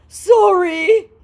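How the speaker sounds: squeaky hammed-up plea; mock scammer voice. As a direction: scammer pleading, mocked. Voicing scammer.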